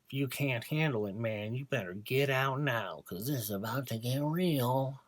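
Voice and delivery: lightly voiced macho host; mock tough-guy warning. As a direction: parody a hammy host warning listeners. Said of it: "So buckle in".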